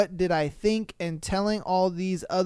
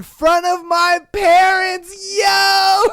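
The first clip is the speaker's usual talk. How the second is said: louder, higher shout of disbelief; shocked shout; 8 semitones higher, 8 dB louder, slower than usual. Real, not acted. Comedian reacting to the thong reveal, shocked shout. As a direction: yell it out, scandalised.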